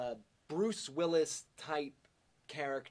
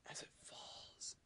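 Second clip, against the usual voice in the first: whispered narration; 16 dB quieter than usual. Acted. Slow motion death.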